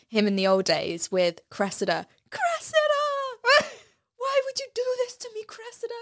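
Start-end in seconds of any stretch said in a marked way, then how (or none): 2.3-3.7 high, drawn-out mock wail of a name; slow, theatrical lovesick call; mock heartbroken cry. Hitch's heartbroken film scene voiced. 4.2-5.9 high, softer hammed-up lament; mock lovesick whimper, pitched way up; mock heartbroken plea. Hitch's heartbroken film scene voiced.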